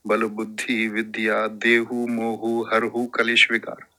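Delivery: low, measured chant-like recital; verse recitation. Shown as a demonstration. Teacher quoting a chaupai to students, recited verse.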